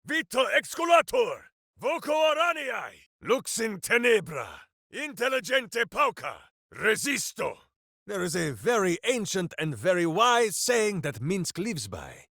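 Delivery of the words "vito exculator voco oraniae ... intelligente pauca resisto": high, shouted Latin spell words; spell incantations. Acted. Ranger shouting spells in combat. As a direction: call out each spell like a battle cry.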